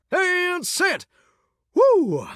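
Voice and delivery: high, drawn-out celebration; giddy, high-pitched announcement; triumphant whoop. Acted. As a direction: whoop as you hit send. Celebrates sending the message.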